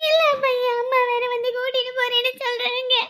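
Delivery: put-on slow, tearful child's wail; crying. Child tells grandparent mother will take them away, crying. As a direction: whine it out through tears. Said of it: "இப்ப எதுக்கு அழுவுற?".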